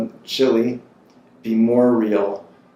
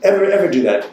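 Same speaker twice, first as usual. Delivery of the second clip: high, loud imitation of a scolding mentor; imitating her cruel scolding of him; mock stern warning; 8 semitones higher, 6 dB louder, faster than usual. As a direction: bark it like a strict teacher, half in jest. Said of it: "she did a rather cruel impersonation".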